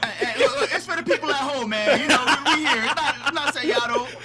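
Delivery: high, loud, laughing self-defence; laughing protest. Real, not acted. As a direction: talk through laughter while pleading your case. Laughing defence against teasing colleague.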